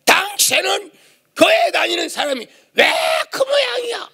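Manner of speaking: high shouted mocking husband; husband's angry rebuke. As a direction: yell it like a fed-up husband. Husband scolding wife, quoted. (남편이 교회 다니는 아내를 나무라며 소리치듯)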